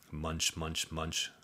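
quiet chewing sounds; munch munch said softly; soft munch sound effect. A narrator's reading. Narrator voices bunny chewing.

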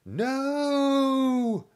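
loud, high zombie hoot; hooting shout. A narrator's reading. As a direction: shriek it as a crowd of panicked zombies. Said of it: "the zombies hooted".

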